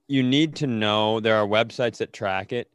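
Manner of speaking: ordinary talk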